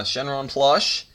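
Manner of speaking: ordinary talk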